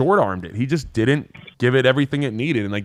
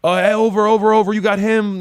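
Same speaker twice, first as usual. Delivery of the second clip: higher, louder on-field call-out; calling to teammate; 9 semitones higher, 4 dB louder than usual. Acted. Defender alerting his teammate in conflict, calling out high and loud. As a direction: call out the switch urgently like a defender. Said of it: "He's communicating".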